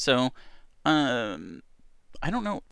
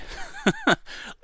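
high laugh. Real, not acted. Lightening the mood.

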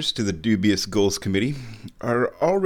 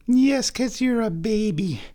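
slow, high-pitched cooing; 10 semitones higher, slower than usual. Real, not acted. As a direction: draw it out high for a pet. Baby-talking the cat.

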